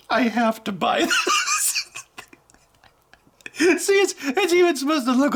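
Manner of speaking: real giggling excitement; laughing. Real, not acted. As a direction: crack up while insisting. Laughing over cheap smartwatch.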